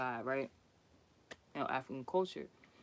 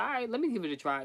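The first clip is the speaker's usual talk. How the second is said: quick, higher retold reply; acted offer; 7 semitones higher, faster than usual. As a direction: toss it off fast, lightly playing her part. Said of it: "She's like".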